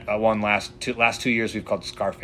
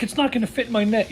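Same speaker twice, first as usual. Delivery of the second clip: much higher, amazed; excited; 13 semitones higher than usual. Retold or imitated. Angler telling his buddy the fish is huge, excited high voice. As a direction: marvel at the size of the catch.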